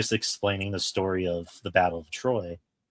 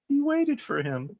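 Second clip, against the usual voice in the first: very high, slow tearful-sounding imitation; imitated moved wife. Wife to husband during the dog scene, imitated as overcome. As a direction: squeak it out slowly, as if overcome. Wife's moved remark, acted out.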